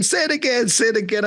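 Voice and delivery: high-pitched mimicry of amused kids; laughing classmates. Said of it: "They would be laughing".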